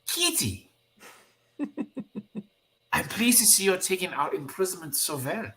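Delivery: put-on high German-accented exclamation easing into teasing; amused German teammate. Teammate pleased at how she takes imprisonment, high German-accented delight.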